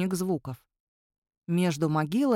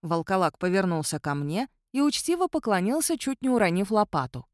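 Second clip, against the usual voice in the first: plain reading voice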